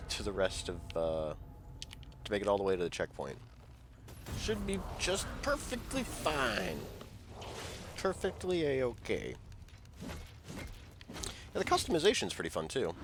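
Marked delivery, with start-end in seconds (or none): 4.4-9.3 sarcastic. Real, not acted. Sarcastic after low health.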